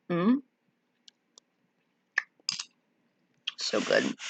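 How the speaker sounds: talking around olives; savoring with mouth full. Real, not acted. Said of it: "put my olives down".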